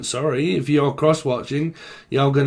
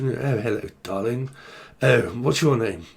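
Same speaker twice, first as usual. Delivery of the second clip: softer, posh English accent; very posh British; 3 dB quieter than usual. Shown as a demonstration. Reader showing how Britons speak, very posh British accent. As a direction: purr a posh British greeting. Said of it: "Speak very posh".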